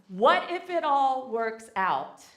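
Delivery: measured, emphatic, each word drawn out; slow, emphatic demo. Shown as a demonstration. Speaker modelling a motto for the audience, slow and emphatic. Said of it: "Say that with me".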